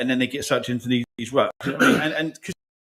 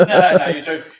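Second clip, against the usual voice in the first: much louder, higher no no you're joking; loud disbelief; 5 semitones higher, 11 dB louder than usual. Retold or imitated. Refusing to believe the client, loud and higher in disbelief. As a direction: protest it can't be true. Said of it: "And I'm like".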